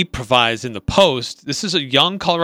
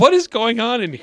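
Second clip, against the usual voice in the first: laughing quote; 9 semitones higher than usual. Retold or imitated. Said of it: "She's like".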